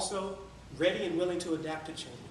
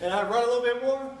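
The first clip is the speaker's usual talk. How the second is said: high, loud push; energetic running take; 6 semitones higher, 8 dB louder than usual. Retold or imitated. Runner recounts running some more, high and loud. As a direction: surge up in pitch and volume, as if running. Acts out running versus walking.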